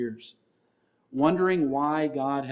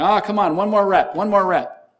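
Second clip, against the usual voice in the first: loud, high trainer's urging; hammed-up shouting trainer; pushy trainer; 7 semitones higher, 10 dB louder than usual. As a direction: egg them on like a gym coach. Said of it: "your trainer's sitting there going".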